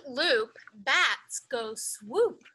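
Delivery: usual speaking voice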